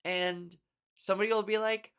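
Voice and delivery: ordinary talk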